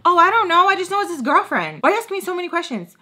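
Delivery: high-pitched, rushed mock whine; defensive exasperation. Roommate dodging questions about the girlfriend, mock exasperated whine. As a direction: imitate someone fed up with questions, pitched high.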